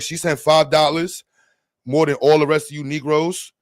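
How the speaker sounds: ordinary talk, nothing marked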